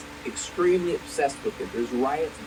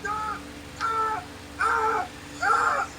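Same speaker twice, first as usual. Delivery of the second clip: sudden screaming; much slower than usual. Acted. Screaming at his friend who offered coffee cake. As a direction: scream out of nowhere, again and again. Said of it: "What are you screaming about?".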